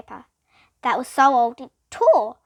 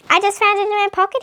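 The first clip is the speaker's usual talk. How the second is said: high, loud, caught off guard; surprised; 8 semitones higher, 6 dB louder, faster than usual. A narrator's reading. Calvin surprised to find the note, loud and high. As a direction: blurt it out, amazed at yourself. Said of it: "sounding even more surprised".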